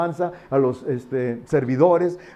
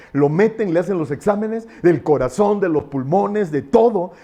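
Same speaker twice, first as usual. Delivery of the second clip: normal talking voice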